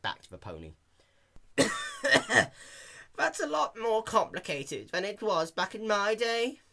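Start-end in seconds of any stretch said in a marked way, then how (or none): 1.4-3.1 put-on old man cough. A narrator's reading. Elderly pony coughs. 3.2-6.6 put-on high old codger grumble; reminiscing old pony; old man voice. A narrator's reading. Elderly pony speaks.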